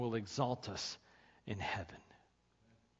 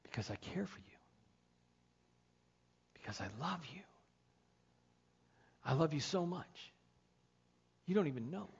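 tender loving God; 5 semitones higher than usual. Acted. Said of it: "He says".

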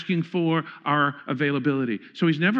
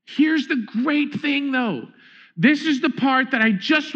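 building excitement; 6 semitones higher, slower than usual. Acted. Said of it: "builds excitement".

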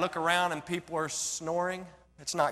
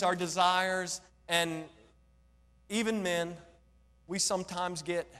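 ordinary delivery

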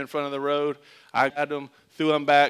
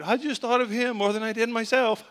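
put-on a higher, rote kid voice; kid reciting line; 7 semitones higher than usual. Boy recites his dad's line to interviewers, rote and higher. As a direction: recite it like a coached child. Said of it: "every time I'm interviewed".

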